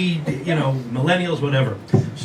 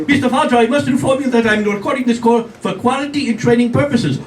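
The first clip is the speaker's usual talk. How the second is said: robocaller voice; 7 semitones higher than usual. Retold or imitated. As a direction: read the script like a call center. Robocaller on the phone.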